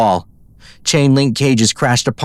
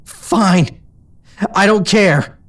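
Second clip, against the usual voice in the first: high, choked with tears; 10 semitones higher, much slower than usual. A narrator's reading. Pegasus refusing to leave her friend, choked. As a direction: force it out through a tight throat. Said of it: "Her voice choked".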